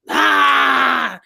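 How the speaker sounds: loud, long open-throated holler; mock battle holler at full volume; mimicked holler. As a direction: holler it out as one big imitation roar. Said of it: "she just gonna holler".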